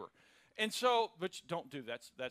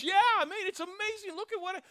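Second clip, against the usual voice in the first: much higher, excited mock voice; gushing; 13 semitones higher, 7 dB louder, faster than usual. Lucky neighbor raves about his new truck in a much higher voice. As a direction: ham up the overjoyed neighbor.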